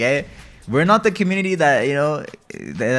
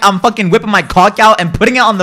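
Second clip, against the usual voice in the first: loud, raging threats at the game; real gamer rage, shouting at the stream; furious yelling; 4 semitones higher, 8 dB louder than usual. Real, not acted. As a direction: blow up at losing, full volume. Raging over losing ranked rank.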